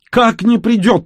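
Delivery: very high, loud woman's shriek; loud, high-pitched distraught question; near-hysterical cry. A narrator's reading. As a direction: cry out in disbelief, almost hysterical. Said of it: "почти в истерике закричала женщина".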